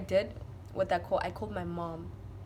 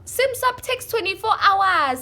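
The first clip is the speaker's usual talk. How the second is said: high, loud exasperated outburst; angry shouting; 9 semitones higher, 9 dB louder than usual. Retold or imitated. Arguing with the store guy about a sim swap, retold shout. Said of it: "don't shout at him".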